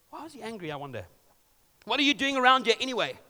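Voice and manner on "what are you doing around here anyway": quick, louder, pitched-up demand; big brother snapping at David. A narrator's reading. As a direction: Eliab confronting his younger brother. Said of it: "he demanded".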